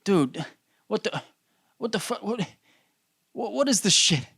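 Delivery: high-pitched, halting disbelief; perplexed. Acted. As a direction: stammer it out in mock bafflement. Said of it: "you look at that and you're perplexed".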